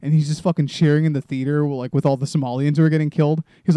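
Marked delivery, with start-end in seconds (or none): none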